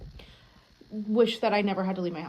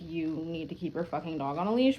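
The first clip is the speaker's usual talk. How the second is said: firm but not mean; 6 semitones lower, 8 dB quieter than usual. Retold or imitated. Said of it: "he wasn't mean to her".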